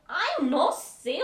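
loud, drawn-out mock exasperation; exasperated. Asked what was happening, acted exasperation. Retold exasperated answer to a question.

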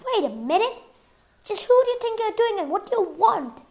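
high, loud indignant protest; angry challenge. A narrator's reading. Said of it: "Frank looked at angrily".

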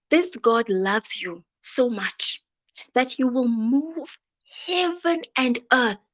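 ordinary talk

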